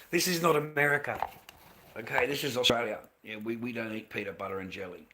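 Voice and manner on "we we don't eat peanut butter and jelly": ordinary talk, nothing marked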